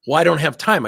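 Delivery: exasperated mock pushback; retold anger. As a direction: act out an irritated man's excuse. Retold angry man pushing back.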